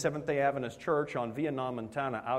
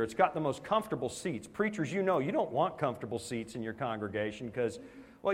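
ordinary talk, nothing marked

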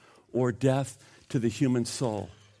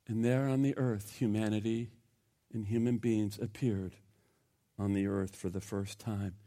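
hushed awe; 7 semitones lower, 11 dB quieter than usual. Real, not acted. Pastor telling the church of humanity's first appearance, hushed. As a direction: drop much softer, lower and reverent.

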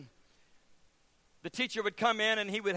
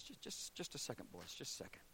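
flustered hush; 7 semitones lower, 18 dB quieter than usual. Retold or imitated. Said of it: "he walks over and he goes".

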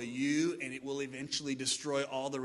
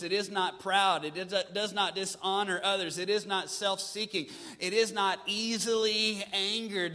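scripture reading; 3 semitones higher, 5 dB louder than usual. Real, not acted. Reading love is patient slowly.

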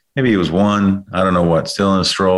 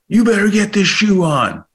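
much higher, aggressive retold scolding; angry at toddler; 14 semitones higher than usual. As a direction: force the words out like you're cramming a shoe on a kid. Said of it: "with aggression and anger".

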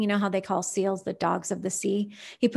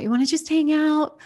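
higher, louder puppy plea; eager dog voice; 6 semitones higher, 5 dB louder than usual. Retold or imitated. Trooper asking his owner to hang out, higher and louder. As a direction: beg like a dog wanting company. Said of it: "he just has to tell you".

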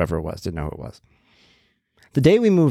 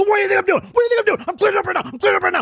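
very high, frantic mock screaming; freaking-out yell; much faster than usual. Mover freaking out at the neighbor, high frantic yelling. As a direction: scream it repeatedly in an exasperated high pitch. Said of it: "I'm freaking out".

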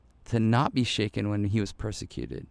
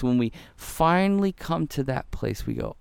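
normal talking voice

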